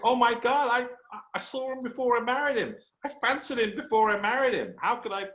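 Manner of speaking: high-pitched imitation of an astonished woman; retold amazement. Wife finds her husband in old scrapbook, high mock amazement.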